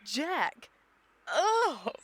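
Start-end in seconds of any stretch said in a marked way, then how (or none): 1.3-1.9 high, loud startled "Oh!"; shocked gasp. Acted. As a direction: cry out at an unwelcome revelation. Startled on learning his name.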